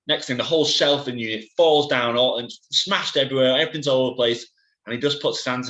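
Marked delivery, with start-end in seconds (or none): none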